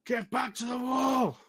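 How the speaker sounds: high, slow, loud barked order; shouting bugbear. Acted. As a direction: bellow the order across the field. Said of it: "looks back and goes".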